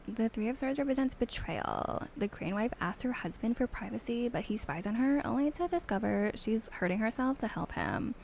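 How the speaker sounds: a hint of a sassy Valley Girl reading out meanings. Mean Valley Girl accent reading card meanings. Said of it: "a mean Tara reading in a Valley Girl accent".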